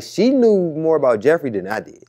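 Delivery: ordinary delivery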